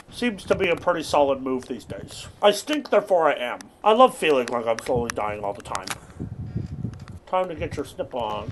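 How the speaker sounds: ordinary delivery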